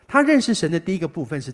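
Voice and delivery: normal talking voice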